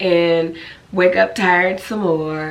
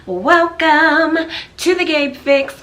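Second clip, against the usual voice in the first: excited greeting; 10 semitones higher, 4 dB louder, slower than usual. Real, not acted. Vlog intro greeting.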